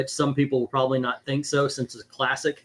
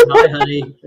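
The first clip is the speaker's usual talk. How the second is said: high, loud laughing mock greeting; laughing imitation; 9 dB louder than usual. Dad teasing Dom about his daughter, laughing imitation. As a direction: tease it through laughter, pitched way up. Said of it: "He says".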